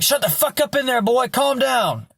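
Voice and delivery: high-pitched imitation of an angry telling-off; mock scolding. Woman tells Logan off through the wall, mock scolding. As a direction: snap it high, as a retold scolding.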